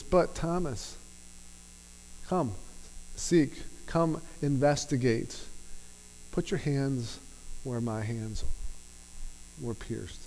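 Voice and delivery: gentle firm invitation. Pretend. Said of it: "gave him truth and love".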